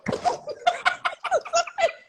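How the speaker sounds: shrieking high laugh; high squealing laugh. Real, not acted. Loud laugh while waiting.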